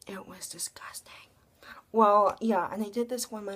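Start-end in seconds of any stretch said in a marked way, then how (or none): 0.1-1.1 hushed disgusted whisper. Real, not acted. Gross-out detail whispered.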